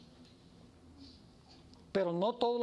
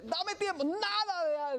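high, choked plea for time; pleading debtor; 10 semitones higher, slower than usual. Acted. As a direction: gasp out a desperate plea.

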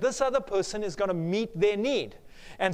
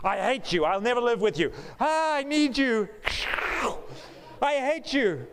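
push-pull lover's quarrel; 5 semitones higher than usual. Acted. Acted on-off couple quarrel.

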